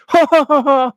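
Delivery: high, loud put-on chuckle; mock chuckle. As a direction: fake a silly British gent's laugh. Mocking a British suitor's laugh.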